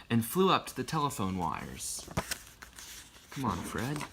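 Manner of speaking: plain reading voice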